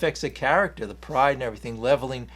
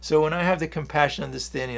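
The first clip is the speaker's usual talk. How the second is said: usual speaking voice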